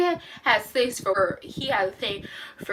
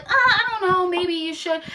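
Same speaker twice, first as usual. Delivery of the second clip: higher, hesitant coy voice; mock coy detective; 8 semitones higher than usual. Detective pretending reluctance to Bruce, higher and coy. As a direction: play it falsely reluctant. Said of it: "at first she's like".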